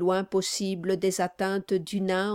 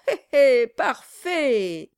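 fawning chuckle; 5 semitones higher, 7 dB louder, slower than usual. Acted. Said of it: "cria-t-il".

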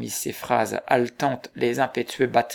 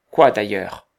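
quick, louder cutting question; sharp interruption; 3 semitones lower, 8 dB louder than usual. A narrator's reading. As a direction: cut in sharply, demanding. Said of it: "interrompit vivement Kester".

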